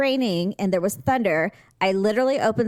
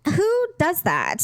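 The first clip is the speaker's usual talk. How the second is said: high, slow incredulous question; indignant disbelief; 9 semitones higher, slower than usual. Retold or imitated. Retelling her reaction to Paul's scolding, high slow disbelief. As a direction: drag it out, high and offended. Indignant retort about Paul.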